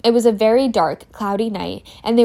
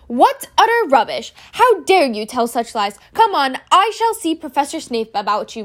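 much higher, louder stern teacher; furious McGonagall; 5 semitones higher, 5 dB louder than usual. Acted. Professor McGonagall catching Malfoy out of bed at night, furious and much louder. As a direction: scold the boy with outrage.

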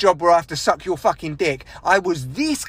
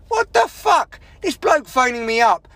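sharp, pitched-up exclamation of outrage; outraged outburst; 3 semitones higher than usual. Real, not acted. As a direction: burst out in disbelief. Outburst in the rant.

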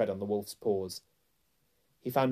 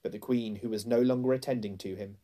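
plain reading voice

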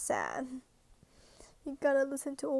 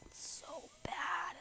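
high, whispery, slow whimper; soft, drawn-out, higher-pitched upset; 5 semitones higher, 10 dB quieter, slower than usual. Real, not acted. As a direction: let it thin out into a tiny despairing whisper. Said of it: "I'm just so upset".

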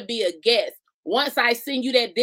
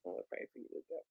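fading whisper; 4 semitones lower, 16 dB quieter, faster than usual. Acted. Fading teasing repeats.

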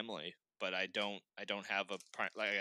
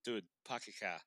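lazy Boston local voice; mock Boston dude, no R's; Boston accent; 2 semitones lower, 9 dB quieter than usual. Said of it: "there's just not an R in sight".

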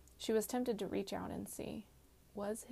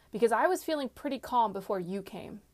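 louder, heated retort; demanding; 3 semitones higher, 8 dB louder than usual. A narrator's reading. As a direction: throw the blame back forcefully. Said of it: "she demanded".

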